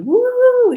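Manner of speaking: high, loud roller coaster whoop; excited scream. Acted. Imagining her riding the roller coaster, excited scream. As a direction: whoop like you're riding with arms up. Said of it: "she's got her arms up in the air and screaming".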